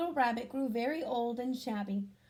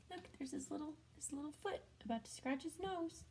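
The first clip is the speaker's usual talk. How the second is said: hushed; 4 semitones higher, 10 dB quieter than usual. Real, not acted. Pointing to picture, hushed.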